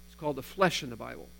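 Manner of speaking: ordinary talk, nothing marked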